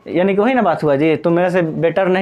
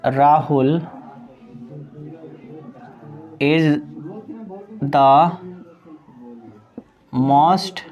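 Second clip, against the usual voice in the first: slow word-by-word dictation; 3 semitones lower, 3 dB quieter, much slower than usual. Shown as a demonstration. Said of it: "जैसे".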